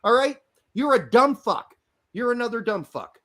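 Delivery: ordinary talk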